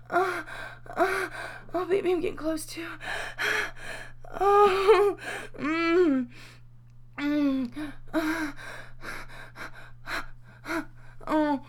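high, panting, moan-broken phrases; urgent, building climax. Acted. Speaker pleading with a lover, urgent and breathless.

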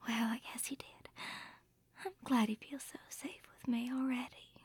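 very soft sleepy whisper; bedtime whisper. Acted.

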